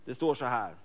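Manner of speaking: ordinary delivery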